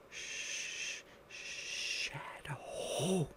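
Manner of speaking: breathless whispered word. Acted. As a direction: whisper it like a secret. Timid caller whispers.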